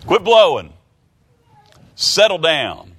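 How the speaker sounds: loud, high command shouted at the wind; Jesus shouting down the wind, a sharp loud rebuke; stern rebuke. Acted. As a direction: order the storm to stop, loud and high.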